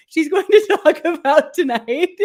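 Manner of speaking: laughing. Real, not acted. Host teasing her co-host about the untold topic, laughing. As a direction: let laughter break into the words.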